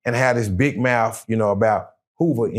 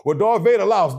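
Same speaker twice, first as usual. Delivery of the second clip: mocking doubters; 8 semitones higher than usual. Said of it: "They go".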